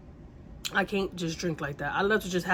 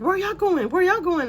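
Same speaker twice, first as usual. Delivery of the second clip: much higher and louder, eager questioning; imitating drunk neighbor; 12 semitones higher, 6 dB louder than usual. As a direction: pitch up and call it out like a tipsy friend. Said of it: "And she's like".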